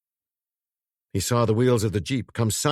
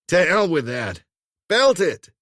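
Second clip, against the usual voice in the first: loud, higher urgent command; forceful urging; 7 semitones higher, 5 dB louder than usual. A narrator's reading. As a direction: bark it decisively. Dermot urging her.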